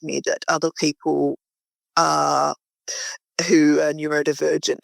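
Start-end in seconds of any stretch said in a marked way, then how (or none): none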